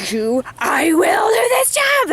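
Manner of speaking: high, loud, slowed battle cry at the ice; fired-up yell. Retold or imitated. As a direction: roar it like you're taking on the rink. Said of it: "I went crazy".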